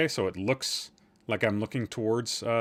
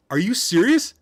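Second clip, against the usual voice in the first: incredulous; 8 semitones higher, 7 dB louder than usual. Shown as a demonstration.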